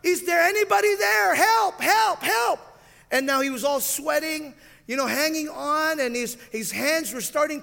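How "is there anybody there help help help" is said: high, loud desperate shout; yelling for help. Retold or imitated. Man hanging from a branch cries for help, loud shout. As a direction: scream for help hanging off a cliff. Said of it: "he began to yell".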